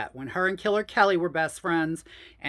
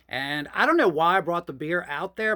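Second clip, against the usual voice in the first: usual speaking voice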